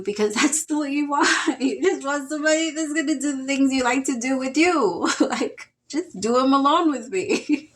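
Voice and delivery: talking through real giggles, pitch up; laughing. Real, not acted. Reader laughing at the client's wish, laughing. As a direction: laugh while you tease them. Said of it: "as soon as I started laughing".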